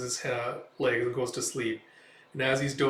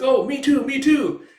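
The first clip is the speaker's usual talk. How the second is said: high, loud, excited little voice; clamor to be included; eager begging; 12 semitones higher, 10 dB louder than usual. Retold or imitated. Sidekick begs for some wine.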